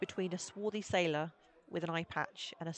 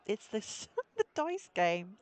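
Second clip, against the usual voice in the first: ordinary delivery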